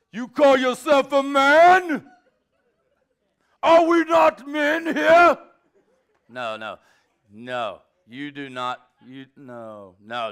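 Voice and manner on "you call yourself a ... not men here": slow, high, full-force yell; shouted challenge. Acted. Preacher models challenge to whining man, shouted. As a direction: roar it out, each word stretched.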